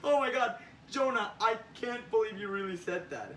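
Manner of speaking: high, loud acted laughing fit; bursting out laughing. Spider-Man laughing at Jonah's line on responsibility, cracking up. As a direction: laugh hard, then talk through it in disbelief. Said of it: "bursts out laughing".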